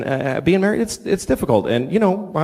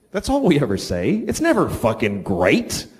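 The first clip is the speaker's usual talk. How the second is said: normal talking voice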